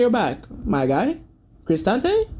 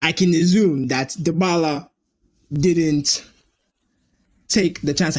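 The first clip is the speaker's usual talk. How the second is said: normal talking voice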